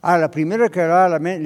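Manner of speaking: ordinary talk, nothing marked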